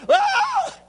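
sudden high yelp of pain with a laugh. Retold or imitated. Preacher retelling the dentist tapping his tooth, sharp high yelp. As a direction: shriek like the dentist hit a nerve. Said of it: "I went".

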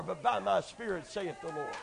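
normal talking voice

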